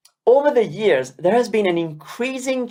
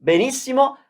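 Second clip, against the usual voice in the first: ordinary talk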